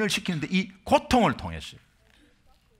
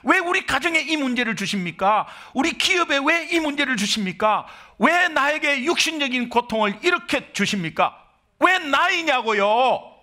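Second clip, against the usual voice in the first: higher, pleading cry of why me to God; complaining prayer; 7 semitones higher than usual. Retold or imitated. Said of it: "간혹 이렇게 말할 수 있습니다". (하나님께 억울하게 따지는 기도)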